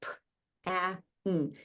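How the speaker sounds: "P, a, n" as three isolated sounds: puh, short a, nnn; separated sounds. Shown as a demonstration. Teacher sounding out pan for spelling, separated sounds. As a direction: sound out "P, a, n" one phoneme at a time, with gaps. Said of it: "what are the three sounds you hear in pan".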